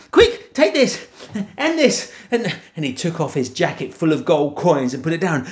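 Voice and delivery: high, breathless urgency; frantic. Acted. Jack and mum panicking as the giant comes. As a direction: rush it in a panic.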